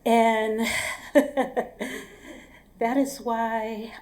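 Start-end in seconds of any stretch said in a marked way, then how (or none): 0.7-2.0 breathy, voiceless laugh. Real, not acted. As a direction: laugh at your own story's ending. Laughs after the story's punchline.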